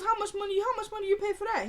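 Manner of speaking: high-pitched nosy questioning; imitated woman. Woman quizzing her captive about the bed, high imitation. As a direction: press the question in a high put-on voice. Acting out woman asking bed's price.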